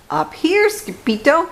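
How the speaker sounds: high, shouted little dog voices; squeaky chihuahua call; hollering chihuahuas. A narrator's reading. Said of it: "hollered the Chihuahuas".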